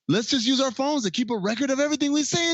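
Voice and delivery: high, fast, frantic mock rant; imitating a friend losing it over phones; going nuts. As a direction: rattle it off in a hammed-up panic. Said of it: "Charlie Day goes nuts and says".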